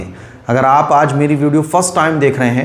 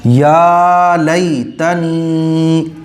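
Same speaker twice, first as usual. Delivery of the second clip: each syllable stretched very long; slow drawn-out recitation; much slower than usual. Shown as a demonstration. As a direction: spell out या लई तनी sound by sound, slowly. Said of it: "clear है?". (या लई तनी को बहुत खींचकर, धीरे-धीरे पढ़ना)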